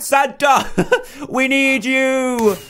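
very high, slow pleading elf cry; high elf calling. Acted. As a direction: call for Santa in a tiny voice. Voicing elf calling Santa.

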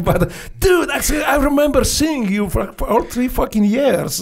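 army guy hooting over three years; amused exclamation. Retold or imitated. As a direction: burst out high in amused disbelief. Army guy laughing at him.

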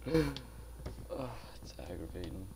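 whispered groan of frustration; frustrated whisper. Real, not acted. Gamer fed up after losing his stuff, whispering. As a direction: breathe it out, fed up.

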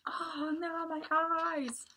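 high mock whimper; hurt tiger. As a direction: ham up the pain. Tiger hit with scallions.

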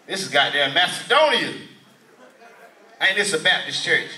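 ordinary delivery

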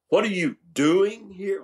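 DOING high and drawn out; DOING lifted and stretched, questioning the activity; stress on DOING. Shown as a demonstration. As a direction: lean on the word doing, slowly. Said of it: "put an inflection on a different word".

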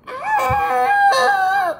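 high piercing shriek of terror; scream. Acted. Mother screaming at the giant ant outside. Mom sees giant bug.

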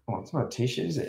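usual speaking voice

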